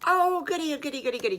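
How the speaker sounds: high, loud gleeful squeal; delighted squeal. Acted. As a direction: squeal it like a thrilled little pig.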